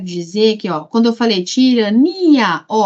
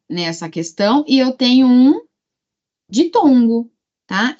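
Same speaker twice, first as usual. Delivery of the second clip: usual speaking voice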